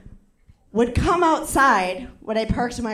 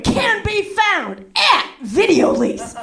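slow, much higher, drawn-out read; mock director demonstrating the line; Fancy Ray directing; 8 semitones higher, 3 dB louder, slower than usual. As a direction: stretch it out like a flamboyant director. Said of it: "I remember him going".

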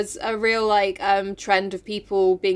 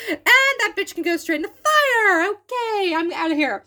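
higher voice, slowed and amused, tossing it off; dismissive, laughing as the note burns; Marmee voiced; 10 semitones higher than usual. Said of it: "She's like".

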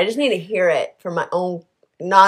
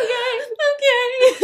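laughing okay. Retold or imitated. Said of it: "And I was like".

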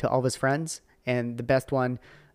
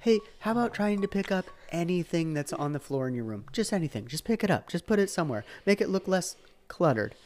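high-pitched, pleading mock-dad voice; exasperated parental nagging, pitched up; 6 semitones higher than usual. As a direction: act out a father wearily coaxing a kid to tidy. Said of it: "heard all of these things before".

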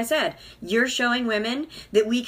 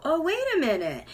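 dawning realization; 7 semitones higher than usual. Retold or imitated. Realization quote.

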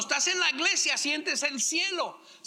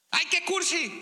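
mocking; 5 semitones higher than usual. Wife mocks husband's sweetness.

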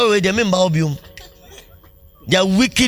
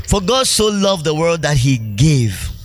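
slow scripture quote; slower than usual. Acted. Quoting scripture slowly.

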